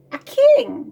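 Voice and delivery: "king" pitched high and loud; emphatic debunking. Acted. Witch tells girl he's no king, "king" hit hard and high. Witch reveals the frog's truth.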